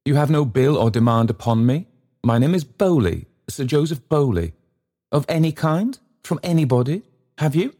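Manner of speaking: high, grandly insistent questioning; high-pitched, self-important baronet; pompous gentleman, demanding. A narrator's reading. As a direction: announce yourself grandly, then press the question.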